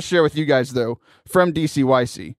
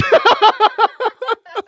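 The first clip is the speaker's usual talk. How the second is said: loud, high burst of laughter; big laugh; 5 dB louder than usual. Real, not acted. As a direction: crack up at the joke. Laughs at his own retold joke.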